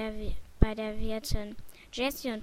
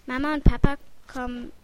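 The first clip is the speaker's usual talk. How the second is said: louder, mildly pleading; begging; 7 dB louder than usual. A narrator's reading. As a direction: plead a little, pushing the first words. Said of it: "betteln Jessie und Thomas". (leicht bettelnd)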